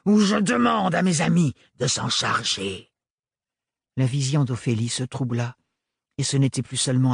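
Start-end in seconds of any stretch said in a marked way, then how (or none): 0.1-2.9 raised threat. Acted. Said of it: "rugit le sans-peur".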